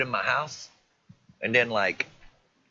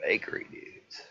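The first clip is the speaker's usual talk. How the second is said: whispering; 8 dB quieter than usual. Real, not acted. Streamer mocking fake video.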